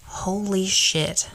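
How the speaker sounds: slow, drawn-out amazement; retold stunned reaction, drawn slowly; awed realization. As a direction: stretch both words out in awe. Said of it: "I went".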